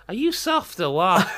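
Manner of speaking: high-pitched attempt at Scouse. Shown as a demonstration. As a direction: send the Scouse accent up high. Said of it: "I was gonna go higher".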